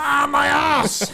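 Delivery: high, loud, drawn-out cry; shouted wail of mishap; real pained yelp. Real, not acted. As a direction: yell it out after a tumble. Mishap with the chair.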